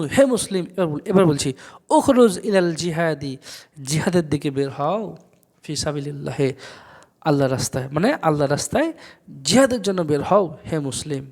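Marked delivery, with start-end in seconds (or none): none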